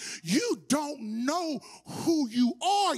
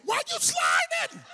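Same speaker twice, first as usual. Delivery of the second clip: laughing disbelief; 13 semitones higher than usual. Retold or imitated. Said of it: "slid in the second".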